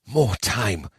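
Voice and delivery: soft muttering through gritted teeth; through clenched teeth. A narrator's reading. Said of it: "muttered through his clenched teeth".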